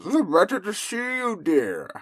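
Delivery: wolf playing sweet granny; high fake granny voice; disguised wolf granny.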